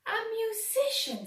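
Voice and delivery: a bird's chirpy surprised echo; Clegane's voice. A narrator's reading. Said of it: "said Clegane".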